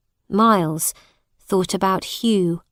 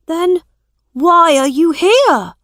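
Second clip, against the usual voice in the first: put-on higher, eager boy's voice; young prince; 9 semitones higher, 8 dB louder than usual. A narrator's reading. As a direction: resolve it like an earnest boy. Edward questions again.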